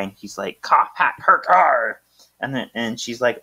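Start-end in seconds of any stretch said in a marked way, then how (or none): 0.6-2.0 raised, higher-pitched mock cry; telepathic cry. [name] signals Marcus telepathically, mock urgent cry. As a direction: call it out in punched words. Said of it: "telepathic cry".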